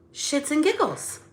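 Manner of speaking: airy and playful delivery; light sing-song. Shown as a demonstration. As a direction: say it breezily, no edge. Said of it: "it's the volume or the inflection".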